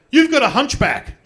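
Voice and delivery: shouted high taunt; loud mocking kid heckle; kid yelling out. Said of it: "some kid yells out".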